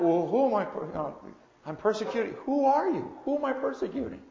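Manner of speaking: mock Saul fumbling his question; wrong reading. Shown as a demonstration.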